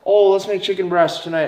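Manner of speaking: high put-on woman's voice. Woman proposing dinner to her man, high mimicked voice. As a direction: play a woman suggesting dinner, pitched high. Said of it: "If, if a woman says".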